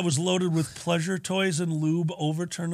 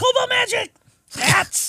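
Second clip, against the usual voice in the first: high squeaky kobold chatter; yappy little kobold squeak. Acted. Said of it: "I got stuck in kobold voice".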